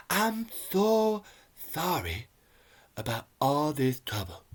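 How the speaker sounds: halting, lisped movie-villain apology; Sam J in Kingsman. Retold or imitated. Reviewer imitating a Kingsman character apologising, halting lisped voice. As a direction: do a lisping impression, starting high. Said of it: "me doing, uh, Sam J in Kingsman".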